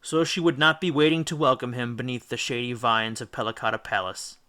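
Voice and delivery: usual speaking voice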